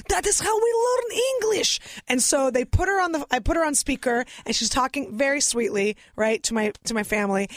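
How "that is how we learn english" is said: much higher mock mom voice; a daughter imitating her mother explaining how she learned English. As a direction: put on the mother's proud voice. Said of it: "And my mom was like".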